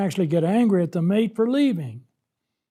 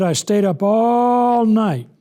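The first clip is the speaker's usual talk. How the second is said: a long, louder, higher "all" in lament; drawn-out complaint; 4 semitones higher, 5 dB louder, slower than usual. Acted. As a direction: stretch "all" long, high and loud. Voicing Hezekiah's sleepless complaint.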